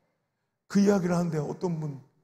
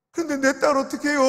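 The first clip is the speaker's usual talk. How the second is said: 딸 pressed, higher and louder; an elder's pleading cry over 딸; desperate plea; 6 semitones higher, 4 dB louder than usual. Retold or imitated. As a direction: ask who will save 딸. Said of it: "그분이 뭐라는지 알아요".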